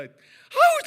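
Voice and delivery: high, loud mock groan of annoyance; exasperated groan. Embarrassed niece groaning about the smelly soup. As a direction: blurt out a fed-up groan.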